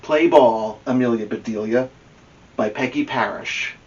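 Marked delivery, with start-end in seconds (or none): none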